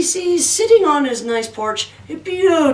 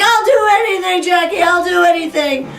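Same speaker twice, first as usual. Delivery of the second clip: high, loud, desperate promise; begging devil; 8 semitones higher, 5 dB louder than usual. Acted. Beaten devil begging Jackie, high and desperate. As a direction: plead frantically, pitched up.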